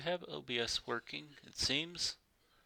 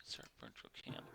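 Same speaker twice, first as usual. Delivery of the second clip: low, soft whisper to himself; whispered aside; 12 semitones lower, 16 dB quieter than usual. Real, not acted. Streamer clicking through his software, soft low whisper. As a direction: breathe the words without voice. Muttering to self during setup.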